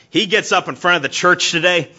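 mock the preacher loudly, with scorn; mocking brother. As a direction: brother loudly sneering at his preacher at the family table. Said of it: "he goes".

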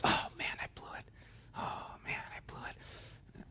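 hushed whisper, caught out after spilling; whispered dismay. Acted. Child to himself after the Cheerios spill, hushed whisper. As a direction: whisper it like a kid who just messed up.

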